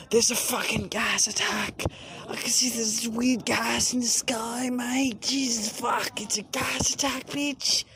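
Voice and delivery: high-pitched, put-on Australian panic; Australian accent. Panicked caller telling 911 about gas, in a high Australian accent. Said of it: "I don't know why I'm doing an Australian accent".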